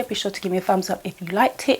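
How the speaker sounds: ordinary talk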